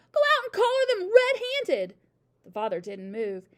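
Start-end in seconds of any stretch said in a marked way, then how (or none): 0.1-1.9 high, loud, frantic command; urgent hiss. A narrator's reading. Mother ordering husband to catch burglars, urgent voiced hiss. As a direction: goad him forward in alarm. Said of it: "hissed the mother".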